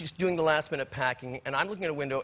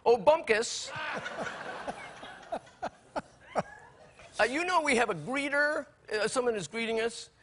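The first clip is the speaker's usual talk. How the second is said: lightly voiced wife, higher and breezy; a hint of the wife chirping at the driver; imitating Nancy; 8 semitones higher than usual. Husband imitating his wife talking to the driver. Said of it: "she says".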